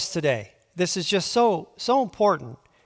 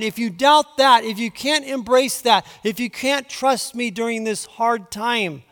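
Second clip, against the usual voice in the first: fervent preaching; 5 semitones higher than usual. Acted. Preacher warning the king not to waver, urgent preaching. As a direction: drive the warning home from the pulpit.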